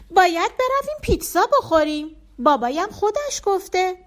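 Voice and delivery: put-on high, bright child voice, pleading; a small boy asking to go for pizza; little boy Bardia. A narrator's reading. Child Bardia telling Allahverdi his dad promised pizza, high and eager.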